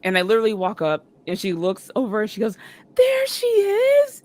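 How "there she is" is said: high, loud, drawn-out squeal; excited greeting. Retold or imitated. Star spotting a fan walking up, excited squeal. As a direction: stretch it out high in delighted recognition.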